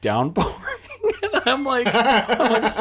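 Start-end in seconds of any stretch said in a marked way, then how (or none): 0.5-2.7 high laugh breaking into the words. Real, not acted. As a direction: laugh through it, much higher. Laughing at the downpour.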